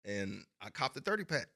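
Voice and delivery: ordinary delivery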